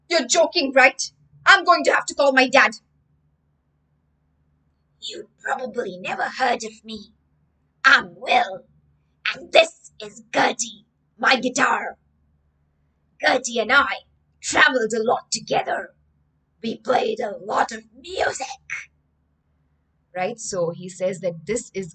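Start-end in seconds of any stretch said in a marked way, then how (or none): 0.1-2.7 loud, high, fast panic; a terrified boy wanting his dad; panicked. Acted. Terrified boy reacts to ghost. 5.0-18.7 put-on louder ghostly old man voice; a gentle ghost introducing himself and his guitar; a dead guitarist telling his story; ghost musician.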